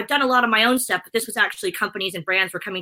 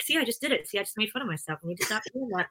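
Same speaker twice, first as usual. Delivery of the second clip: a hint of a quiet aside to herself; muttered aside; 9 dB quieter, faster than usual. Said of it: "I always say".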